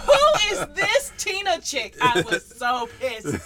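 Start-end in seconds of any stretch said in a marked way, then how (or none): 0.0-2.0 high, loud and laughing; laughing mock jealousy. Mocking her own jealousy over Tina, laughing. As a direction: laugh through a jealous jab.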